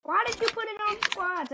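ordinary talk

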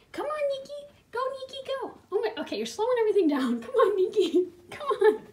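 high coaxing call to a cat. Real, not acted. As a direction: urge the cat on, high pitched. Urging cat to come eat.

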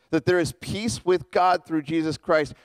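usual speaking voice

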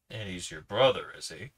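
ordinary delivery

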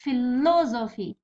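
Philosophy slowly, leaning on 'lo'; stress on LO. Shown as a demonstration. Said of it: "هو لو".

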